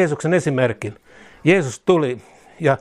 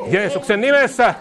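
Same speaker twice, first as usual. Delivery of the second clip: rising to a shout; 10 semitones higher than usual. Acted. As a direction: cry it out in triumph. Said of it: "Jeesuksen nimessä repeated".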